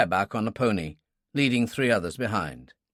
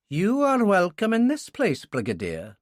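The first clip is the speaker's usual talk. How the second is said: a lightly voiced Highland welcome, pitched higher; Highland accent; 9 semitones higher than usual. Landlady welcoming the Brigadier in a light Highland accent. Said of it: "she said in a Highland accent".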